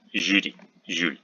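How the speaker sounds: Julie and Jules with a softened zh, "zhyu"; soft J. Shown as a demonstration. Teacher showing French borrowings, soft zh in Julie, Jules. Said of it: "soft J".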